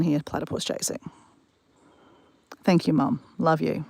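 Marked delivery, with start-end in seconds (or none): none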